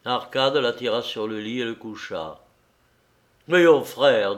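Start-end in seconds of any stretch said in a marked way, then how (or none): 3.5-4.3 loud, firm appeal; determined urging; resolute. A narrator's reading. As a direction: take a friend firmly in hand. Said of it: "dit-il d'un air résolu".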